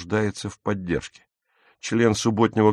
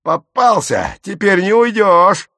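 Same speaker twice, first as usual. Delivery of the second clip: loud, high catch-you shout; staged, full-voiced angry triumph; triumphant yell; 10 semitones higher, 7 dB louder than usual. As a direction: shout it as if seizing a culprit. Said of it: "заорал он".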